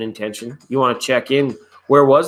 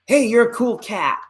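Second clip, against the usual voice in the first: encouraging pat on back; 10 semitones higher than usual. Pretend.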